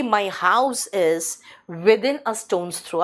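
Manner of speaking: very slow, every word spaced out; teacher reading the example sentence slowly to the class; slow model sentence. Shown as a demonstration. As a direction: dictate it like a teacher. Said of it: "तो मैं कहूँगी".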